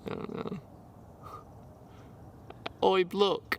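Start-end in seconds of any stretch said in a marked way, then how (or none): 2.8-3.6 higher-pitched put-on Australian call; mock Australian accent. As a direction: hail someone in a hammy Australian accent. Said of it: "Australian accents in here".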